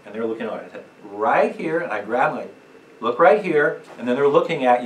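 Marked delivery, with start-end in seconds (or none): 1.0-1.8 firm command. Shown as a demonstration. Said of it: "Use a command voice". 3.0-3.8 loud command voice. Shown as a demonstration. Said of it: "Use a command voice".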